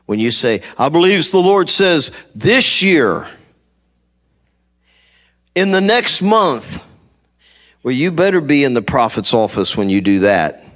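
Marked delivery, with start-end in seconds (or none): 0.8-6.8 mock prophecy. Mock prophet giving dated word.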